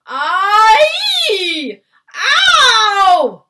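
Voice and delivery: long high yowls of pain; giant's roar. A narrator's reading. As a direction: howl at full volume, each cry drawn out. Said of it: "roared the flesh lump eater".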